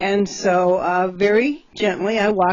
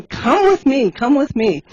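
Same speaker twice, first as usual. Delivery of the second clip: urgent call; 5 semitones higher, 4 dB louder than usual. Retold or imitated. Urgently fetching Glenn for help.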